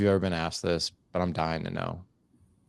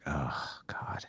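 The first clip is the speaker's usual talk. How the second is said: breathy whispered dread under the breath; 4 semitones lower, 9 dB quieter than usual. Retold or imitated. To himself on hearing the dirt bike plan, a whispered groan. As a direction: let it slip out as a horrified whisper. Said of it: "and I was just like".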